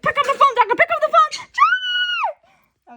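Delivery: high, loud frantic yell; frantic shouting. Retold or imitated. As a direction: shout into the phone desperately. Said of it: "He's like".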